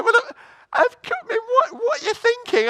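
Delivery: very high, squeaky protest; hammed-up squeal of disbelief; mock exasperation. Preacher arguing with the laughing congregation, mock exasperated.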